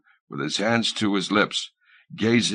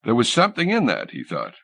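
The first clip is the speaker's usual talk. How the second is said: usual speaking voice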